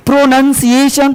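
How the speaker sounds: प्रोनंसिएशन spelt out by ear with a short "nun"; careful teacher's प्रोनंसिएशन, "nun" not "noun"; correct pronunciation. Shown as a demonstration. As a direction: repeat the model प्रोनंसिएशन, no "ow" sound. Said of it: "प्रोनाउंसिएशन नहीं होता".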